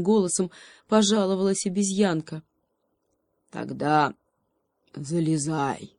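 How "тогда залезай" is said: lightly voiced low rumbling bear; a slow rumble, lightly done. A narrator's reading. Bear inviting the monkey in, lightly voiced rumble. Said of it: "прогудел медведь".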